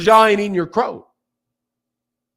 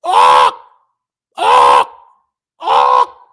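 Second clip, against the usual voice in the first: very low, loud shouted squawks; crow squawk; 6 dB louder, much slower than usual. Retold or imitated. Explaining why you eat crow, low loud squawks. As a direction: bark out harsh crow squawks. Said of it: "What are crows known for?".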